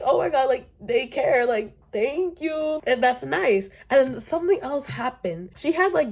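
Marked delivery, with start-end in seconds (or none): 0.0-2.8 high, slowed mock thanks; mock grateful. Imitating how a grateful girl would thank him. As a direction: gush out a fake thank you. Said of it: "you would think".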